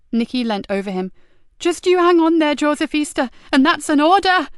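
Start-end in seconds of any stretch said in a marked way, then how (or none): 1.6-4.5 high, loud urgent order; officer ordering dying sergeant to hang on; tearful command. Acted. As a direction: command him to hold on. Nicky orders him to live.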